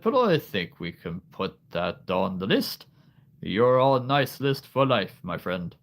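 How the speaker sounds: booming festive greeting easing into kindly chat; jolly Santa. Pretend. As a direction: play a hearty, kindly department-store Santa. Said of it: "The deep voice".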